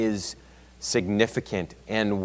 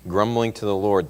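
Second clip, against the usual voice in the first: ordinary talk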